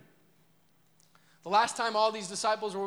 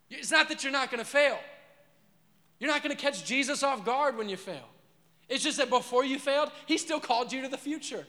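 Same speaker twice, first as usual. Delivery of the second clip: higher, louder sermon voice; full-force preaching; 6 semitones higher, 4 dB louder than usual. Acted. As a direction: preach it out over the room.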